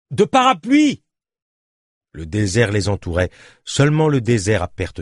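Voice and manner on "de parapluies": high, raised voice; shouted reply. Acted. Journalist answering his companion in the sandstorm, shouted reply. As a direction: call the answer back over the storm.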